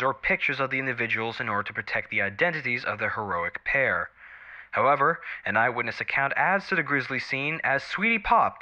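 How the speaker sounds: TV news read. Acted. News anchor reporting an attack to viewers, crisp news read.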